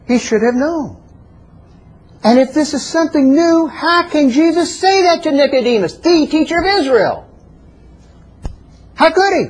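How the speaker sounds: high, impassioned questioning; fervent preaching. Real, not acted. Preacher challenging his listeners about Nicodemus, fervent.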